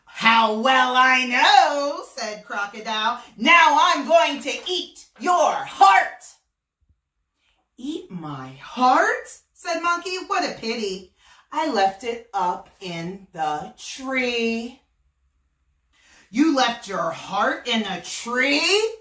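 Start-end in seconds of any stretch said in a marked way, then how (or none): 0.0-2.0 gloating crocodile. Acted. 3.4-6.2 menacing crocodile. Acted. 7.8-9.4 mock surprise. Monkey surprised. 10.2-14.8 feigned regret. Acted. 16.3-19.0 louder, slow, disbelieving crocodile; grumbling crocodile. Acted. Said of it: "Crocodile grumbled".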